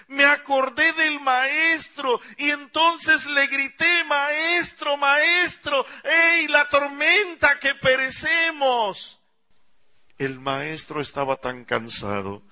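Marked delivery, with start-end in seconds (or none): 0.1-9.2 high, loud, slow cry over the storm; desperate shout. Acted. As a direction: call out to wake him as the storm roars. (grito desesperado, agudo y fuerte)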